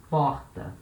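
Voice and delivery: porta with a tapped r, "POR-ta"; Portuguese word. Shown as a demonstration. Teacher modelling porta for learners. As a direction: stress the first syllable of porta. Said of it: "you have the word porta".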